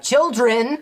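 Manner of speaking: captain's summons. Retold or imitated. Retelling the captain calling his kids, mock summons. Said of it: "he's like".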